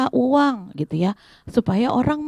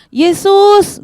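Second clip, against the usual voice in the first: loud high shout; calling a name; 10 semitones higher, 8 dB louder than usual. Acted. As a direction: holler the name over a crowd. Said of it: "dia panggil nama-nama Yesus". (berteriak keras memanggil nama)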